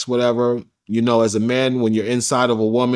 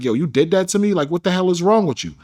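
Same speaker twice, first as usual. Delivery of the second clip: higher, raised, heated questioning; retold angry confrontation; 6 semitones higher than usual. Retelling confronting her, heated and raised. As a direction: demand an answer, furious.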